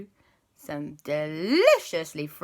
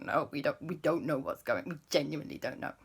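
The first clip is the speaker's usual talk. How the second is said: soft, lower, hurried denial; sheepish denial; 3 semitones lower, 7 dB quieter, faster than usual. Acted. Parents denying the rocket to the guide, quietly. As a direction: mumble it guiltily. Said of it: "Mummy and Papa were like".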